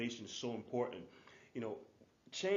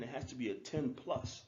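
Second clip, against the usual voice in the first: ordinary delivery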